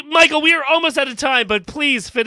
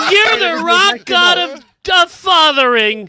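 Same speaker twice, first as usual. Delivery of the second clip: slow, louder, grand announcer tribute; hammed-up tribute; 7 semitones higher, 6 dB louder, slower than usual. Cohost hamming up the kid's thank-you line, slow grand tribute. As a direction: boom it out like a rock anthem. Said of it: "say out loud".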